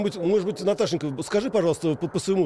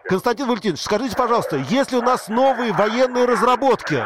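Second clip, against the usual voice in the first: loud, raised voice over noise; to someone hard of hearing; 9 semitones higher, 6 dB louder than usual. Real, not acted. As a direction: speak up for a bad line. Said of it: "говорите громче". (громко, чтобы перекричать шум)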